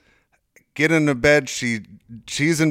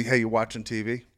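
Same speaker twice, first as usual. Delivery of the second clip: much softer, tentative question to the wife; quietly asked; 3 semitones lower, 8 dB quieter than usual. Retold or imitated. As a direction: ask gently so as not to wake her. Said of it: "So I say".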